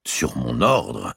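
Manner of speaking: slow, stunned question; stupefied. Acted. The king stunned by Robert's accusation, slow disbelief. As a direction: repeat it as if you cannot believe it. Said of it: "dit Philippe stupéfait".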